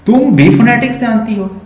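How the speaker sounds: high-pitched, disbelieving question; astonished. Shown as a demonstration. Teacher showing greater surprise at student knowing phonetics, astonished. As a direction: ask in amazement, voice leaping high. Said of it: "अगर और भी हैरान हो जाऊँ".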